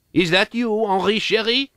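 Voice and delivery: put-on elderly French mother calling from inside; mother's call. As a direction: call sweetly to your son coming home. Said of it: "my dear mother is in the habit of saying".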